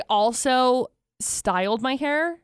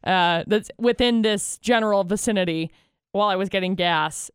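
ordinary talk

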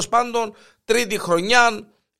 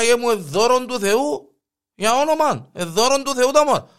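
a priest pleading piously with the mayor; 6 semitones higher than usual. Acted. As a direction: beg sweetly like a pious priest. Priest pleads for another raise.

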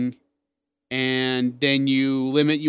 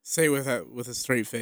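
quiet aside; 4 semitones lower, 12 dB quieter than usual. Real, not acted.

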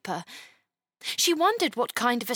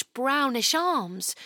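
usual speaking voice

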